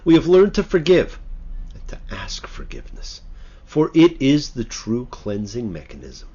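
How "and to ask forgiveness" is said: hushed. Real, not acted. Sermon, hushed aside.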